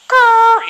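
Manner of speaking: high loud buzzing alarm imitation; mock alarm noise. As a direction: imitate the emergency alert tone. Imitating emergency broadcast alarm.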